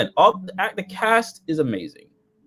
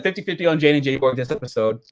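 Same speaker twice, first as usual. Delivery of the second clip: normal talking voice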